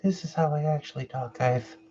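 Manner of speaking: small, high, soft joke voice; fake real voice. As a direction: pretend your natural voice is tiny and high. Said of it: "This isn't even my real voice".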